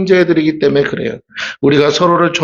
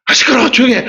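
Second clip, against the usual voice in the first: exasperated parent shouting; annoyed shout; 9 semitones higher, faster than usual. Retold or imitated. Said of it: "내가 막".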